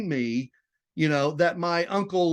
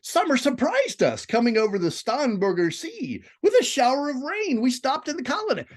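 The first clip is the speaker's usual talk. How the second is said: much higher, lighter, happy woman's voice; female voice; 10 semitones higher than usual. Shown as a demonstration. Teacher reading Princess Marie's lines: high, happy female voice. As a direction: lift up into a bright lady's voice. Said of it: "It's a female voice".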